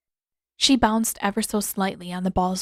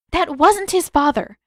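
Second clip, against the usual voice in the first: hasty; 8 semitones higher, 7 dB louder than usual. A narrator's reading. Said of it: "Katara inserted hastily".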